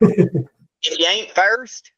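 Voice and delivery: drawling mock-Southern movie line; Southern accent. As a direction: do a slow, put-on Southern accent. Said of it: "do Ricky Bobby in a Southern accent".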